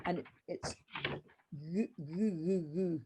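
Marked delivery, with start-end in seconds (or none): 1.5-3.0 "goo" repeated softly with a gliding DH sound; quiet "goo, goo" with the G softened; soft DH demo. Shown as a demonstration. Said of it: "the D H is like a softer G".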